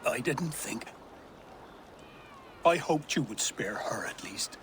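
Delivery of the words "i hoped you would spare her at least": grieving whisper. Acted. Ealdorman grieving over burned Modron.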